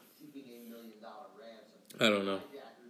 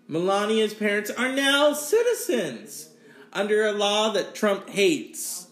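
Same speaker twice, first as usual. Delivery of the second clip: ordinary talk, nothing marked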